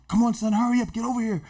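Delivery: high, urgent call to hurry; dad hurrying his son over. Retold or imitated. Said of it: "My dad's like".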